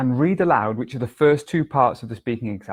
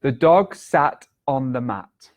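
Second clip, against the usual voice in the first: slow dictation; slower than usual. Shown as a demonstration. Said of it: "which is".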